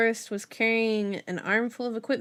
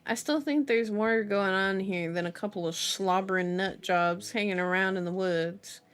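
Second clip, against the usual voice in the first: usual speaking voice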